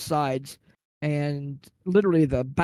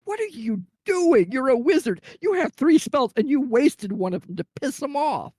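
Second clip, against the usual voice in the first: much higher mock disbelief from the other players; incredulous outcry; 10 semitones higher than usual. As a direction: players can't believe the wizard wasted a spell. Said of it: "Everybody's like".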